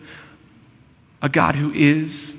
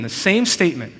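ordinary talk, nothing marked

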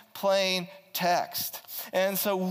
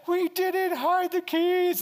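put-on high, slow child voice, far higher pitch; kids denying; 12 semitones higher, slower than usual. Kids insisting to dad they didn't hide keys, childlike voice. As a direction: whine it like a kid caught out. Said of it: "And they're like".

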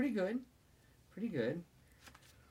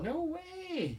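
indignant denial. Real, not acted. Dad denies laughing.

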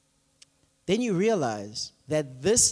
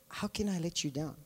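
gentle God voice; 3 semitones lower, 10 dB quieter than usual. Retold or imitated. God answering, voiced softly.

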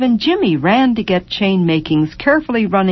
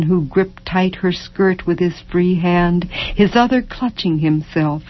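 usual speaking voice

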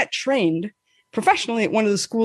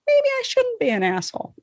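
inner voice; 10 semitones higher than usual. Retold or imitated. Inner thought.

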